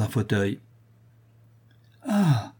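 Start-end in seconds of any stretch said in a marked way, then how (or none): 2.1-2.5 a high, blissful sigh of relief. Acted. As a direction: sink into the armchair with a happy ah. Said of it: "s'écria-t-il avec un sourire de béatitude".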